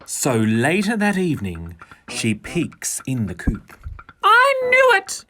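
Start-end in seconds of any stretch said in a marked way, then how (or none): 4.2-5.2 very high, louder gotcha; Dora, triumphant. A narrator's reading. Dora catching her hens gone out, high and triumphant. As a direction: crow it with delight. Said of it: "thinks Dora".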